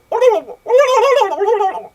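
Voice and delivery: high-pitched warbling gobble; loud prank noise. Retold or imitated. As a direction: trill wildly at full volume. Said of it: "like, really loud".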